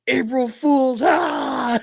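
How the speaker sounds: put-on high, loud April Fools shout as a six-year-old; gleeful kid shout. Dad imitating his daughter yelling April Fools, high and loud. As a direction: crow it like a kid who pranked her dad. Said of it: "she goes".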